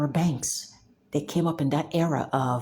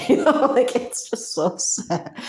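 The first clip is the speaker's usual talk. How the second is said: laughing; 7 semitones higher, 4 dB louder than usual. Real, not acted. Laughing at sad history.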